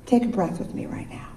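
whispered invitation to breathe. Real, not acted. Pastor whispering to the congregation, inviting a shared breath. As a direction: hush the words as you breathe together.

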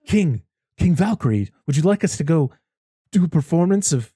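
a hint of a bad British accent, eager to please; terrible British accent. Retelling Matt Damon's bad British accent to a friend, mock British. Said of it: "Matt Damon in his terrible British accent".

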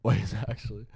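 usual speaking voice

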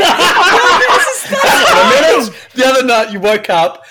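loud, high, slow through laughter; real laughing yell; laughing shout. Real, not acted. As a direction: burst out laughing and cry it.

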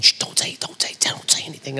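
urgent whisper, hushing. Retold or imitated. Wife whispering to her friend not to tell. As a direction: hush him in a whisper so he won't hear. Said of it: "keep it quiet".

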